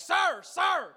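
high, loud hail to a passing cop; mock frantic flag-down; calling out. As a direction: wave down the cop. Flagging down a cop.

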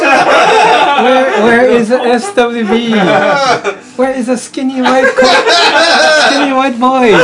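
high, laughing question; asking while cracking up. Real, not acted. Joking question through laughter.

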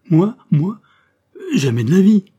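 high, loud protest; horrified refusal. A narrator's reading. Colonel refusing to stand on a horse, horrified. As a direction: balk in alarm, pitched right up. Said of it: "fit le colonel épouvanté".

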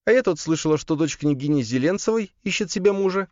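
very high female character voice; sister character. A narrator's reading. Sister bringing up a possible bride to her brother, high voice. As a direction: voice the sister well above your own pitch.

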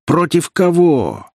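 high, drawn-out challenge; outraged veteran taking them on; indignant. A narrator's reading. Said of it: "возмутился какой-то ветеран". (возмущённо, с вызовом)

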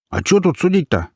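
high-pitched heckler chiming in; high, pushy little troublemaker; piping hamster character. A narrator's reading. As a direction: pipe up in a high voice. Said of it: "подал голос хомяк".

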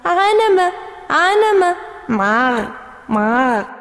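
high teasing sing-song mimicry; mock her by mimicking. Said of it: "صار سمير يضحك أكثر". (طفل يسخر من جود بتقليد نطقها)

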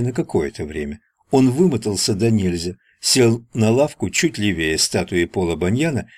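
usual speaking voice

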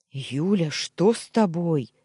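loud, alarmed roar of her name; roaring call. A narrator's reading. Husband finds wife sobbing, roaring in alarm. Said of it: "взревел я".

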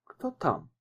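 softly asked from behind a door; soft, guarded question through a closed door; wary call through door. A narrator's reading. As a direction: ask cautiously who's there. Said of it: "спросила из-за двери".

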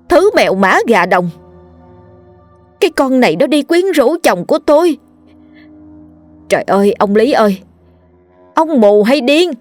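furious screaming. A narrator's reading. Wife screaming at husband and mistress, enraged. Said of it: "Bà gào lên".

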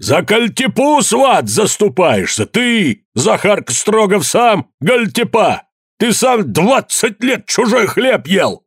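high, drawn-out furious yelling; frenzied shout. A narrator's reading. Евдоким turns on his in-law Захар, frenzied shouting. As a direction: rage at him, stretching every accusation. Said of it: "свирепея закричал иступлённым голосом".

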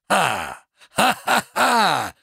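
high, slow stage "ha-ha-ha"; theatrical scornful laugh. Acted. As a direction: laugh bitterly in drawn-out tragedian style.

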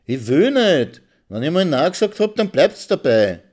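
high, loud, fast snap; flat refusal, shouted; wife rebuffing her husband loudly; angry refusal. Acted.

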